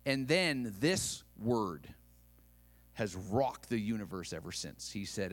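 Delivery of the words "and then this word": normal talking voice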